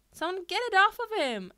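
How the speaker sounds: high, loud panicked yelp. A narrator's reading. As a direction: shriek for help. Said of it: "Ashido yelped".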